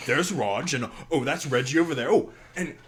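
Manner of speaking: captain introducing his crew, cheery and high; cheery minotaur captain. Acted. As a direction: wave at each crewmate as you go. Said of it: "He's like".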